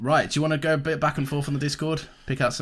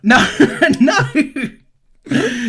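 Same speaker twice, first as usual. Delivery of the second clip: loud high laugh into a laughing no; laughing protest; 14 semitones higher, 10 dB louder than usual. Real, not acted. Protesting the server deletion joke.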